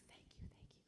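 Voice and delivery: breathy whispered thank you twice. Retold or imitated. Boy whispering his thanks as he walks away. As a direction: whisper it gratefully, barely voiced.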